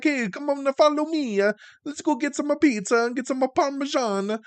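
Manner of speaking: mock Italian guy, overdone. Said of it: "stereotypical Italian".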